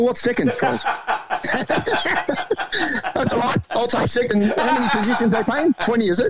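amused, laughing while asking. Real, not acted. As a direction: laugh it off while you talk. Joking about coming second.

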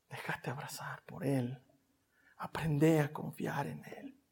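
soft, low whisper. Real, not acted. Preacher inviting the listener into God's embrace, whispered. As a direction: invite them gently.

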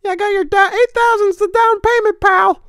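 high squeaky cartoon mouse; Mickey Mouse voice. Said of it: "my Mickey impression".

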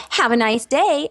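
high, over-cheery false greeting; mock American cheeriness. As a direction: chirp it like a fake-friendly American. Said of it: "Europeans will make fun of Americans".

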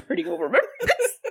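high, laughing repeat; repeating a friend's joke through laughter. Real, not acted. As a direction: giggle the line back.

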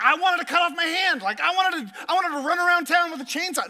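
joking outrage at the rule book; hammed-up sarcastic complaint; mock sarcasm. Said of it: "I'm like".

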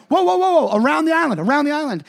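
high, loud rapid whoas; acted-out alarm from the passenger seat; panicked warning. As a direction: warn the driver urgently. Retold panicked warning to driver.

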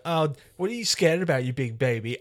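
high, mocking teen jeer; mock taunt. Film teenager taunting the scared friend, mock jeering.

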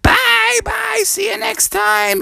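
very high, squeaky goodbye; group farewell. Acted. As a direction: chirp it like a crowd of little frogs. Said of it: "and they all said".

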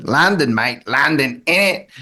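put-on lightly done dodgy Cockney accent; a quick hint of Cockney. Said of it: "enough of my dodgy Cockney accents".

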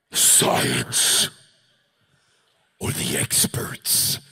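breathy scornful whisper. Real, not acted. Speaker sneering at science and experts to his audience, whispered. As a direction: sneer the words under your breath.

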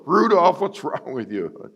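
high, loud coach's bark at the name; coach yelling. Retold or imitated. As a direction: yell the name like an angry coach. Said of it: "the coach is like".

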